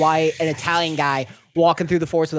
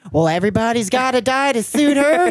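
higher put-on bird voice; mock bird echo, pitched up; parrot mimicry; 6 semitones higher than usual. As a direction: parrot the king's line back. Parrot on guillotine repeats line.

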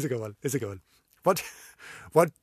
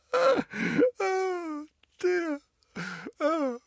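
high, wheezing laugh-cry; helpless tears of laughter at her own pun; much slower than usual. Real, not acted. Laughing till she cries at her own dog joke.

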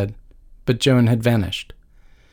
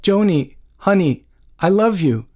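high, pleading tearful appeal; understated narrated crying; 7 semitones higher than usual. A narrator's reading. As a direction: plead with a touch of tears. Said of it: "Dana was crying now".

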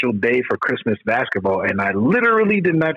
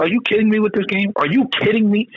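disbelieving yell; 10 semitones higher than usual. Retold or imitated. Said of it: "I was screaming at the T V".

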